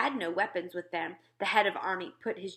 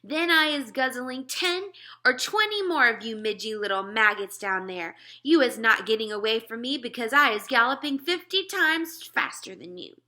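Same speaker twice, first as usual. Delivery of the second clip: higher, louder taunting giant; gloating giant; 4 semitones higher, 7 dB louder than usual. Acted. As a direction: jeer at the little soldiers below.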